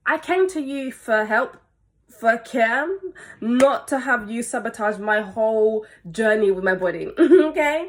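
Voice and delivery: emphatic, aggrieved confrontation; hurt protest with a pointed final okay; indignant. Real, not acted. Indignant address to the offender.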